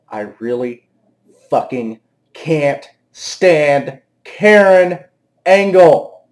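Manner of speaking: slow, word by word, building in volume; emphatic loathing. Real, not acted. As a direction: spell out your loathing one word at a time. Emphatic repeat of his dislike.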